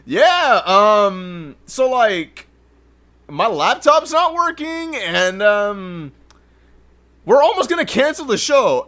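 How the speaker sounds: pitched well up and unhurried, the words stretched out; mock Peachy voice.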